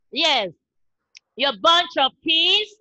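ordinary talk, nothing marked

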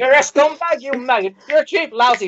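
hammed-up stand-up rant; mock comedian rant. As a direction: bellow the insults like a club comic. Acting a ranting comic.

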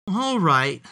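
storybook piglet giving in; mild sighing. A narrator's reading. Piglet agrees to stay still, mildly sighing. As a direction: let it go with mild resignation. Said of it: "sighed the piglet".

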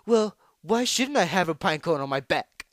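high, loud defensive comeback; flustered retort. A narrator's reading. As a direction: snap back indignantly, caught off guard. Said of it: "Flustered, Trixie struggled to come up with".

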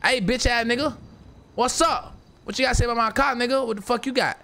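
acting out squaring up at him; retelling calling the guy out over his car; retold confrontation. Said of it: "I'm like".

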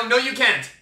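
ordinary delivery

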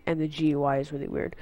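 ordinary delivery

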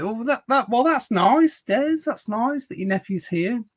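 high, sing-song mock delight; mock sweet. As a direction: coo it back like a sugary aside. Reacting to chat message, high voice.

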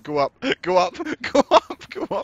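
frantic high shouts, laughing; panicked shouting. Real, not acted. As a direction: scream it in panic. Fleeing monsters up a ladder.

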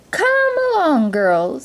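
high, drawn-out singsong call; summoning stepmother. A narrator's reading. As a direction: usher the girls along grandly. Stepmother summons her girls.

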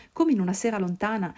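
ordinary delivery

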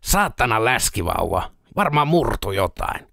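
slow, pained grumbling through gritted teeth. A narrator's reading. Peltonen nursing his foot after the statue fell, slow pained grumble. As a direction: drag the words out against the pain. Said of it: "sanoi hammasta purren".

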